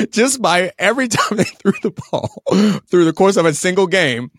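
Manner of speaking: high, loud laughing through words. Real, not acted. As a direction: laugh while you say it. Laughing at bad stats.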